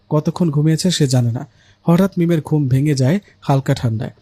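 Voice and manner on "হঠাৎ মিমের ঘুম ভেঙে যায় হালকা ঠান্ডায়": usual speaking voice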